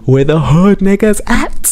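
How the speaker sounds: high, slow party yell in a put-on voice; hyped-up slow holler; retold yell. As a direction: call it out across the street like a catchphrase. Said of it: "I would just kept yelling out".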